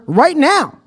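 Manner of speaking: hammed-up ad urgency; exclaimed. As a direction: punch it with an exclamation point. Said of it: "Another exclamation point".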